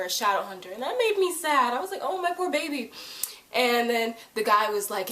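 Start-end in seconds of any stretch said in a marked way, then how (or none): 2.0-2.9 high, cooing sympathy; pitying coo. Retold or imitated. Fan feeling sorry for Luke, pitying coo. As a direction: coo it high with mock heartbreak. Fan pities character she loves.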